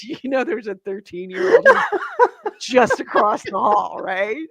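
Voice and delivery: quoting through laughter; can barely get the words out for laughing. Retold or imitated. Wife retelling husband's warning, laughing through it.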